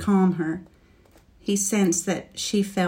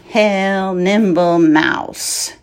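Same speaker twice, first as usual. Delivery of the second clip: slow, loud dragon greeting; 6 dB louder, much slower than usual. Acted. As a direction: drawl it out like a dragon.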